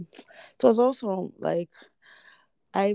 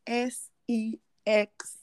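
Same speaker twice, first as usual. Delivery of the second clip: spelled out slowly; 5 semitones higher, much slower than usual. Shown as a demonstration. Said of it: "spelling the word out".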